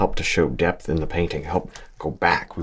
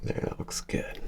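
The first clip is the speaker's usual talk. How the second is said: whisper; 11 dB quieter than usual. Real, not acted. Quiet approval of own stroke.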